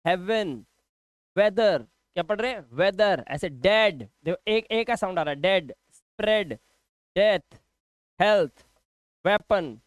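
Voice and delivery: usual speaking voice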